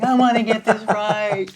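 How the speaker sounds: loud, high, eager outburst; eager pupil. Retold or imitated. Adoring pupil wanting the mentor's answer, hammed-up eagerness. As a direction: act desperate to please, voice jumping up.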